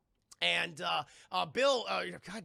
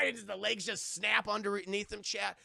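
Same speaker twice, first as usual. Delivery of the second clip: ordinary talk, nothing marked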